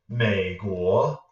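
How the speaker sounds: MEI GUO in a single dip-and-rise; one big third tone. Shown as a demonstration. Teacher recapping the trick, word joined in one third tone. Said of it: "make it one big third tone".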